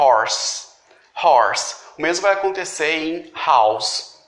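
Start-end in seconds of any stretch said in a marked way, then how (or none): 0.0-1.8 horse starting with a breathy H, like Portuguese R; English H. Shown as a demonstration. Teacher models the English H sound, clearly. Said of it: "a pronúncia é".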